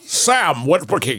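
lightly voiced heroic robot; put-on Transformers voice. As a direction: intone the name with mock gravity. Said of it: "going to use that voice?".

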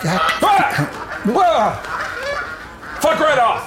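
shrieking in panic, high and loud; startled yelling. Real, not acted. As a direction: yelp as geese attack. Attacked by geese at bar.

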